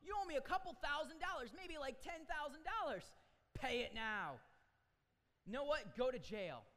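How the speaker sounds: furious shout demanding the money; furious creditor. Acted. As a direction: scream it at the man you're choking. He grabs him by the throat.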